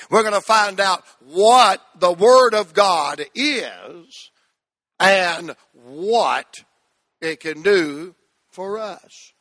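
slow, firm repeat of the phrase; slow recitation. Shown as a demonstration. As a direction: say it slow with them. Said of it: "Say that with me".

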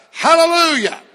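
high, loud hallelujah; shouted hallelujah. Real, not acted. Preacher leading the congregation in a loud shout. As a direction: let hallelujah ring out high. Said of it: "Can we do that one more time".

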